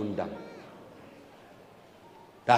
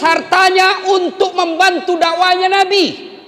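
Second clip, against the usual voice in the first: high, loud, impassioned preaching; preaching at full force; 10 dB louder than usual. Acted. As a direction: cry it out to the crowd. (khotbah berapi-api dengan suara tinggi)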